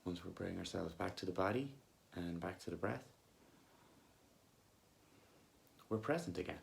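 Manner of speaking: soft, unhurried meditation voice with long pauses; guided meditation. Acted. Coach leading listeners back to body and breath, calm guided meditation. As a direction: gently guide them back to the breath, leaving long silences.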